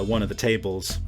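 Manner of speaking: normal talking voice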